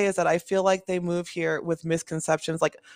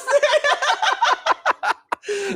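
long high laughing fit. Real, not acted. Laughing hard with friend at the joke. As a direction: let the laugh run on.

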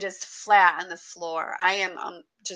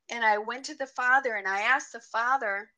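usual speaking voice

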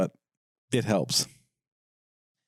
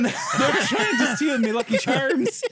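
mock Irish leprechaun; 8 semitones higher, slower than usual. Leprechaun riff.

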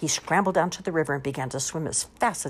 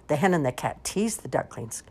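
ordinary delivery, nothing marked

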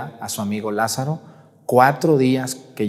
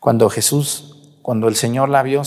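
ordinary talk, nothing marked

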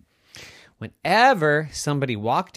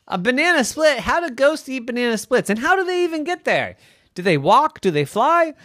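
high, loud, rapid-fire questions; baffled mom piling up questions; incredulous; 14 semitones higher, 6 dB louder, faster than usual. Acted. Baffled Mommy fires questions.

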